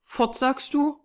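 put-on slow, high child's question; little girl exclaiming. A narrator's reading. Small girl hearing that Mama is gone, puzzled exclamation. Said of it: "rief die Kleine".

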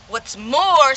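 loud sharp snap; loud indignant snap; snapped. Acted. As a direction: bite off the words in anger. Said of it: "snapped the smar-, Lorax".

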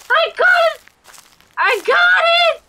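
very high, loud squeal of triumph; thrilled high-pitched shout; excited squeal. Real, not acted. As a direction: squeal it in delight, twice. Grabs the item in game.

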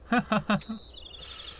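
high, breath-only laughter; amused giggle. Real, not acted. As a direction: giggle softly in a long run. Amused by the game dialogue.

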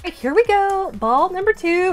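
normal talking voice

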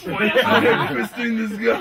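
laughing mock yell. Aiming the line at Lucas, loud laughing chant. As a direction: shout it at Lucas while laughing. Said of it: "it'll be Lucas".

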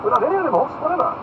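high, loud lordly retort; ringing aristocratic defiance; defiant Duke. Acted. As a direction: answer emphatically, without a qualm. Duke defiantly answers the challenge.

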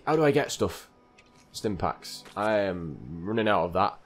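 usual speaking voice

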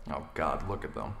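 low muttered disbelief under the breath. Acted. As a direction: mutter it to yourself, disgusted. Grumbling at fan across room.

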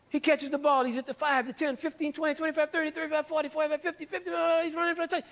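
high, excited play-by-play announcer; sportscaster call. Pretend. Teacher mimics a football announcer calling a touchdown run.

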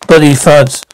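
ordinary talk

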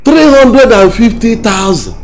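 loud, high outcry over the price; incredulous shouting. Acted. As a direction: shout the sum in disbelief. Incredulous at the sum requested.

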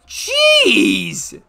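very high, drawn-out yell; exclaimed shout. Acted. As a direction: belt it out loud and stretched. Exclaims at the auction price.

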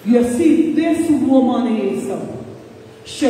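usual speaking voice

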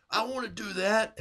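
put-on high, eager childlike exclaim; eager kid. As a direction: sound like an excited kid. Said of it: "I'm saying".

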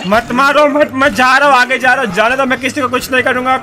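loud, urgent begging to be let through; panicked pleading. Real, not acted. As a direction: plead loudly while trying to get away. Begging attackers to let him pass.

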